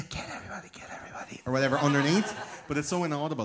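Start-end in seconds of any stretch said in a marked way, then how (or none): 0.1-1.4 hissed subliminal whisper; creepy subliminal whisper. Shown as a demonstration. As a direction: breathe it like a hidden message. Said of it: "some kind of whisper is like".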